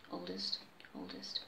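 correcting a misread word under the breath; muttered correction. Real, not acted. Reader fixing a misread word, low mutter.

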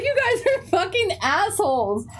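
high, laughing fake insult; laughing mock scold. Teacher laughs while mock-cursing her pranking students. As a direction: scold them through laughter.

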